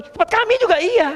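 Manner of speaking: squeaky playful agreement; mock eager reply. Preacher jokes answering the question, high playful.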